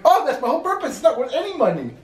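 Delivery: high, loud exclaimed protest; acted outburst. Grave owner dismissing money for his plot, acted outburst. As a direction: burst out with it, pitch way up.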